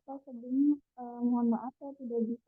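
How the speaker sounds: faint and far away; barely audible. Real, not acted. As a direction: voice too quiet to hear. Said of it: "Suaranya kecil banget".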